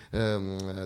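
ordinary talk, nothing marked

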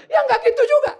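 very high, loud retort; mock indignant protest. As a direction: imitate a flustered caller objecting. Caller protests the counsellor's inference. (protes melengking dibuat-buat)

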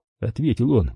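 plain reading voice

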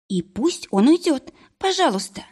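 a hint of a frightened patient; nervous young woman. Mari voiced.

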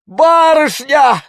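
high, loud, drawn-out shout; sudden cry. A narrator's reading. Village elder finds the young lady, crying out. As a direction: shout out in shocked recognition. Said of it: "вдруг крикнул староста".

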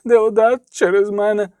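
high, plaintive whimper; sobbing. A narrator's reading. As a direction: wail it like a guilt-stricken boy. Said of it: "зашльохав хлопець".

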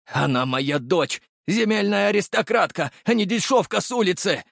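high-pitched outrage, climbing after the first words; father's impassioned high-voiced objection; indignant protest. A narrator's reading. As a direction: defend your daughter with wounded pride. Father indignantly defends his daughter.

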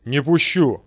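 loud, resolute refusal; firm refusal. A narrator's reading. Ghost refuses to let them at the gold, firmly. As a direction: stand your ground, loud and final. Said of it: "твёрдо сказал я".

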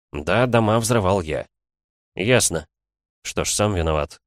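plain reading voice

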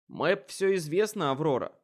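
loud, high objection; shouted retort. Acted. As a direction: shout back at her. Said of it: "возразил я".

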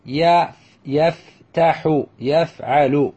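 فتح يفتح يفعل slowly in separate beats; each syllable of يفتح and يفعل held apart. Shown as a demonstration. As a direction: sound out fa-ta-ha, yaf-ta-hu for فتح يفتح. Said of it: "مثل".

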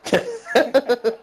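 high and loud, a hammed-up yelp; mock outburst. Swearing never to hear this podcast, a hammed-up loud squeal. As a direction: squeal the word out in playful horror.

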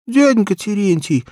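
put-on high child's voice with a sob; sobbing child. A narrator's reading. Little girl sobs to the shoemaker, high tearful plea. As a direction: whimper his name through tears. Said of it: "всхлипывает Фёкла".

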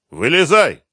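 high, loud command; barked order. A narrator's reading. Colonel orders captive out of the car, commanding. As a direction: order him out sharply.

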